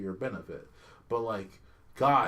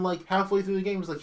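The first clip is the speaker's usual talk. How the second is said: ordinary talk, nothing marked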